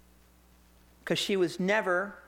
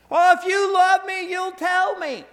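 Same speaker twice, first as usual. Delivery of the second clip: high, loud wailing mock sob; fake crying plea; 10 semitones higher, 9 dB louder than usual. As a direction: whine it like a tearful wife. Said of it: "And she starts crying".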